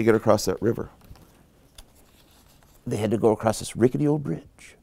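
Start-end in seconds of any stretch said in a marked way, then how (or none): none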